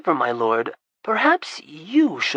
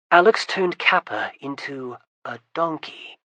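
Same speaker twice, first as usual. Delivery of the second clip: ordinary delivery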